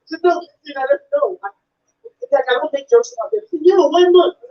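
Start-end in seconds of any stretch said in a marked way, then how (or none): 3.7-4.3 much higher, slurred imitation of a deaf fan's speech; imitated deaf fan. Fan praising the comedian's stuff, high slurred imitation. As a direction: gush like an eager fan who talks fast. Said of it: "he was partially deaf".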